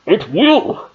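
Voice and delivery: high put-on villain voice, rising at the end; Bane impression. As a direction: do the masked villain in a high, altered voice. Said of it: "let me do my Bane impression".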